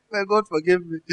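usual speaking voice

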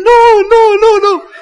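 very high, loud run of protests; hammed-up squealing shout of refusal; mock panicked shouting. Husband protesting after his wife has spoken, mock shouting. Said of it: "shouting and saying".

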